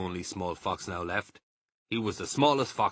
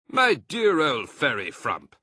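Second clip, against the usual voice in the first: higher, louder fond scold; 6 semitones higher, 9 dB louder than usual. A narrator's reading. Mr Fox chiding Badger, fond scolding. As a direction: chide him affectionately.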